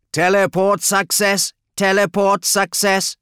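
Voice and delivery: high, slow machine announcement; droning automated announcer; mechanical voice. Acted. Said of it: "droned a mechanical voice".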